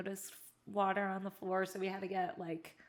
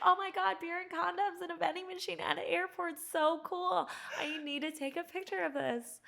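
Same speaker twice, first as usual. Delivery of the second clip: put-on thrilled teen; 10 semitones higher than usual. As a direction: gush like a starstruck teenager. Teen thrilled at vending machine.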